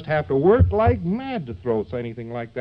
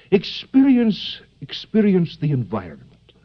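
mock German psychoanalyst accent; 3 semitones higher than usual. Commanding Freud to experience his surroundings, mock German accent. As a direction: play a 1950s German analyst in a hammed-up German accent. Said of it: "the proper accent of psychoanalysis".

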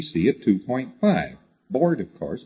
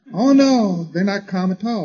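emphatic; 9 semitones higher, 5 dB louder than usual. Real, not acted. Contradicting the imagined onlooker.

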